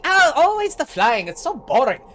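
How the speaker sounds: high, loud whine of boredom; exasperated complaint. Acted. Pirate grumbling as he swipes at a flying wizard, exasperated. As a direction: gripe loudly, pitched well up. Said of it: "he's like".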